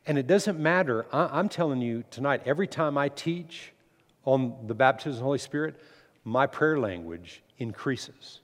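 usual speaking voice

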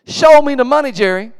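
very loud, high, hollered catchphrase; imitated demanding yell. Rod demands proof from Jerry on the phone, loud yell. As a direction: holler it like a fired-up athlete.

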